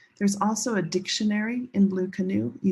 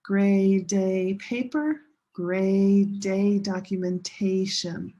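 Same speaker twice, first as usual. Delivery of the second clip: slow color-word practice; 3 dB louder, slower than usual. Shown as a demonstration. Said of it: "Use your hand".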